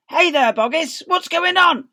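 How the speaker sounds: loud, high call down; calling villager. Acted. Villagers calling down to Boggis in the crater. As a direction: shout it down to the farmers.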